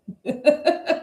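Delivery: short amused laugh. Real, not acted. Reader laughing at the spread. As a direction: breaks into a real laugh.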